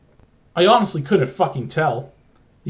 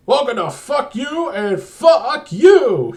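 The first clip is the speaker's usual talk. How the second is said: loud, slow, much higher game-show yell; 8 semitones higher, 6 dB louder, slower than usual. Acted. Game show host yells at the audience, slow loud pitched-up yell. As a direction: bellow it slowly like a crazed host, pitched way up. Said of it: "he yelled".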